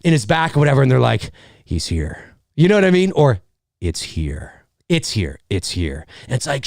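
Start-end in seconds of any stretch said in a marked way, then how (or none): 1.6-2.4 very low, soft dread; hushed horror-movie line; ominous hush. Acted. Acted ominous discovery of body. 3.8-6.1 low, soft repeated warning; hushed horror-movie chant; ominous hush. Acted.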